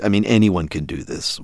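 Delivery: normal talking voice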